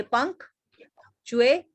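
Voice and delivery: चुए and शम said slowly, each on its own; syllable by syllable. Shown as a demonstration. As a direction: break the word into separate slow beats: चुए, शम. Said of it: "इसके तीन syllable बन गए". (एक-एक syllable अलग करके, धीरे)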